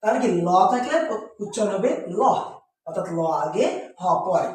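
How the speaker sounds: ordinary talk, nothing marked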